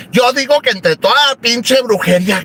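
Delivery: ordinary talk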